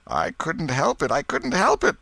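high, wailing repeated plea; moaning. A narrator's reading. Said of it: "Potter moaned".

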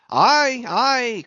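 high, loud answering shout; high-pitched sailor's "aye"; excited cry. A narrator's reading. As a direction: shout back to the lookout. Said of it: "cried Stubb".

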